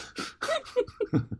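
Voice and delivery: high squealing laugh; a piercing burst of laughter; shrieking laugh. Real, not acted. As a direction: laugh shooting up into falsetto. Falsetto laugh.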